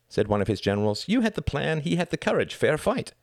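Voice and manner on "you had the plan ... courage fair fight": put-on high-pitched boy settling a dispute; boy's voice. A narrator's reading. General tells Reg he lost fairly, boyish voice. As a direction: reason with him in a high boyish voice. General reasons with sore loser.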